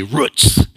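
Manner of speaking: "Roots" with a short oo as in "foot"; mother's pronunciation. Retold or imitated. Preacher repeating mother's word for the film, "Roots" with short vowel. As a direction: say "Roots" to rhyme with "puts". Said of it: "as my mother used to say".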